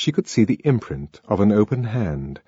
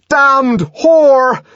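loud, high raging curse; enraged bellow; furious shout; 9 dB louder than usual. A narrator's reading. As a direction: roar the curse, both words hammered. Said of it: "he shouted".

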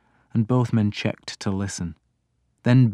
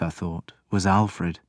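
ordinary talk

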